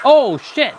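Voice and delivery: loud sudden outburst; startled shout. Real, not acted. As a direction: blurt it out in alarm. Gamer startled mid-game.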